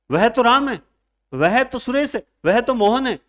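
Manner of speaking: high and loud, pressing on वह; emphatic. Shown as a demonstration. Teacher shows insistence to the class, high and loud. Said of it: "जोर देकर कहा".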